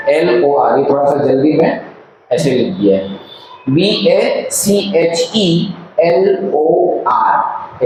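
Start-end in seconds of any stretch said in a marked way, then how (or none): 3.7-7.8 very slow, each letter separate; spelled letter by letter. Shown as a demonstration. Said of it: "ऐसे तो हम पढ़ेंगे". (अक्षर अक्षर धीरे धीरे बोलते हुए)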